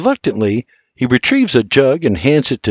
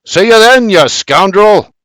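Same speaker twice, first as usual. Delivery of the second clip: much higher, louder parting shout; shouting goodbye; 9 semitones higher, 6 dB louder than usual. A narrator's reading. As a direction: call out while waving the jug. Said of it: "Dean shouts".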